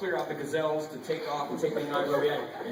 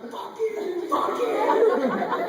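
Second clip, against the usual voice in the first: screamed, far higher and much louder; retold full-throated yell; yelling at the top of lungs; 7 dB louder, much slower than usual. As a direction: scream it at the animals with all your lungs. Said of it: "yelling at the top of my lungs".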